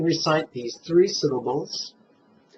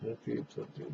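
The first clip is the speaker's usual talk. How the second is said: quiet try-it demo; 3 semitones lower, 16 dB quieter than usual. Shown as a demonstration. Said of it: "Try it".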